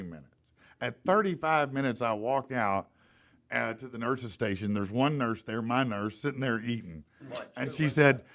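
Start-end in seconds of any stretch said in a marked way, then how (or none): none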